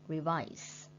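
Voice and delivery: reVISE, second syllable strong; stress on second syllable. Shown as a demonstration. Teacher repeating Revise with stress on VISE. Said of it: "stretch mark on second syllable like".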